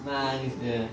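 ordinary talk

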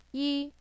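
yi held high and level; high flat tone on yi. Shown as a demonstration. Teacher models number one for learners, high flat yi. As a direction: say yi on a high, even pitch. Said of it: "That's a high flat tone".

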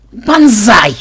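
loud, high-pitched frantic call; yelling on the run. A narrator's reading. Ksenobait calling Banzai while running from Koschei, in a high yell. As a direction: bellow the name high and urgently. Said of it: "орал на бегу Ксенобайт".

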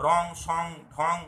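"রং, সং, ঢং", each ending in ng; anusvar as ng. Shown as a demonstration. Teacher lists rhyming examples for learners, anusvar pronunciation demo. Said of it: "অনুস্বারের প্রকৃত উচ্চারণ হচ্ছে উঁয়োর মতো".

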